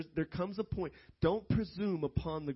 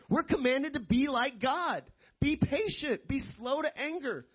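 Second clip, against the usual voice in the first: high-pitched, insistent pulpit delivery; emphatic preaching; 8 semitones higher, 4 dB louder than usual. Real, not acted. Preacher teaches congregation about anger, emphatic high-pitched preaching.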